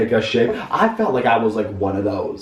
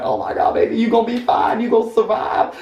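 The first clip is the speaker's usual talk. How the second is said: fussy comforting women; 9 semitones higher than usual. Retold or imitated.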